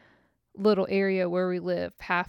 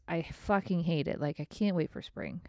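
ordinary delivery

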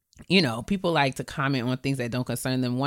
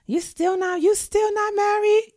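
very high, shocked nosy question; nosy relative. Retold or imitated. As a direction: squeal it like a nosy aunt.

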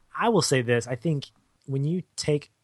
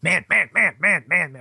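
lightly voiced barking sea lion; a hint of a sea lion bark; mimicking a sea lion bark; 6 semitones higher, 6 dB louder than usual. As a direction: bark the words a little higher. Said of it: "I think it was like".

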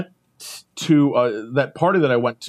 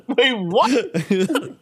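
laughing disbelief; 6 semitones higher than usual. Retold or imitated. Stunned reaction, laughing.

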